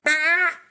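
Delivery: sudden high-pitched eagle squawk; eagle cry. Retold or imitated. Imitating the eagle calling him to follow. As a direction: mimic the eagle calling out sharply. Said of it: "and he goes".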